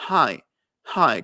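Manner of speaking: hi with breath from the throat; English h. Shown as a demonstration. Teacher showing the glottal h in hi. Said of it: "Cuando digo hi".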